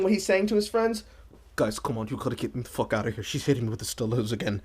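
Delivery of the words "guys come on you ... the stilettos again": low, fast desperate plea; panicked boyfriend. Retold or imitated. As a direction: beg your buddies to rescue you quickly. Voicing the boyfriend to friends.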